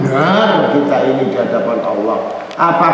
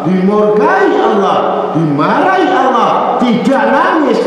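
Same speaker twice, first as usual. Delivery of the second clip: high, impassioned sermon cry; a preacher thundering against hypocrites, in a high, fervent voice; fiery preaching; 10 semitones higher than usual. Acted. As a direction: rebuke the flock with rising fervor. Long impassioned sermon passage.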